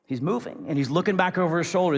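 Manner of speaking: ordinary talk, nothing marked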